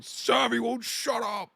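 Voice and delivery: high, drawn-out exasperated outburst; mock snapping. Imitating streamer fed up during super chats, mock snapping. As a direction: snap it high, stretching the words. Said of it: "That's usually who he snaps on".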